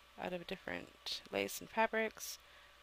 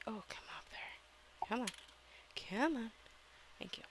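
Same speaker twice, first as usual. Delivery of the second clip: whispering; 6 dB quieter than usual. Real, not acted. Coaxing an object off a hanger.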